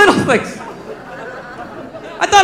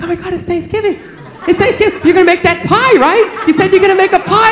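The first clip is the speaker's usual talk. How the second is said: put-on excited girlfriend; 6 semitones higher than usual. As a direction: remind him cheerfully about the promised pie. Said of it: "She's like".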